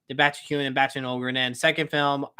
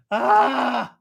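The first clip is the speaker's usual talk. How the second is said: frustrated cry; 7 semitones higher, 6 dB louder than usual. Real, not acted. Overwhelmed by favorite Pixar question.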